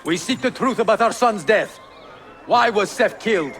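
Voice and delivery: demanding shout. Acted. Demanding answers from Abbas in hall.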